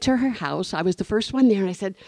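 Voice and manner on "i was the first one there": ordinary delivery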